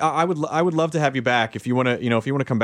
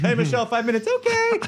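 high set-call, leaping higher on the reply; acted high voice; 9 semitones higher than usual. Calling Michelle on the movie set, put-on high voice. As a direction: pitch it way up, playfully chirped.

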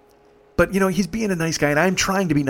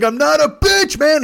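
high, loud screamed protest; acted scream; 12 semitones higher, 6 dB louder than usual. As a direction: scream back at your friend in a retold fight. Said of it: "He screams it".

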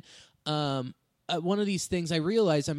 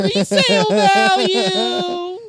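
mock salesman voice; 10 semitones higher, 9 dB louder, slower than usual.

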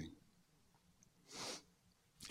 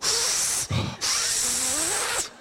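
desperate breathing. Retold or imitated. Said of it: "I started going".

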